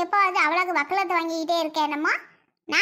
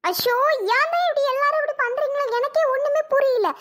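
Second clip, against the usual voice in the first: distressed protest; 4 semitones higher than usual. Acted. Accused woman protests to everyone.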